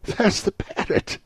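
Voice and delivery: high, loud, drawn-out cry of delight; excited cry. A narrator's reading. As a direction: cry out in slow, thrilled amazement. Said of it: "cried Scrooge".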